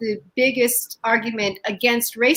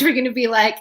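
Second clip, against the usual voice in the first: normal talking voice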